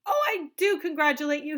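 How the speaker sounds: high, loud congratulation; cried. A narrator's reading. Wonka crying his congratulations to Charlie. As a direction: gush with delight. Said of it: "he cried".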